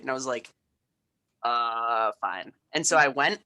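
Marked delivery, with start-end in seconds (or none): 1.4-2.5 grudging. Retold or imitated. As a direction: give in with a sullen sigh. Grudging reply to parents.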